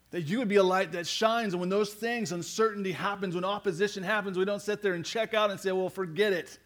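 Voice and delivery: high, driving sermon delivery; fervent preaching. Real, not acted. Preacher urging the congregation to know Jesus closely, fervent preaching.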